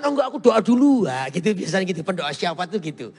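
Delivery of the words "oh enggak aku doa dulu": pious refusal. Retold or imitated.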